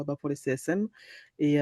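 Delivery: ordinary delivery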